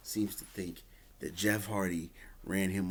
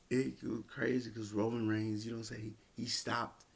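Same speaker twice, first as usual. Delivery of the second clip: normal talking voice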